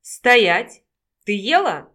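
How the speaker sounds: loud bossy "stop!"; sharp command. A narrator's reading. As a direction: halt your friend with a bark. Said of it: "как Пущина проворчала".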